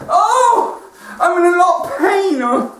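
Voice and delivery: high whiny moan; moaning bloke. Retold or imitated. Injured bloke moaning in emergency, high whine.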